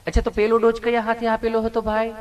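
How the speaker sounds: lightly voiced sweet nurse, gently asking; a hint of a tender nurse coaxing a patient; loving nurse. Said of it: "nurse બેને એવું પ્રેમથી પૂછ્યું". (પ્રેમથી પૂછતી નર્સનો હળવો અવાજ)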